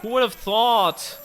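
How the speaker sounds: high sing-song sarcasm; mock wonder, overdone; fake surprise. Sarcastic reaction to chat.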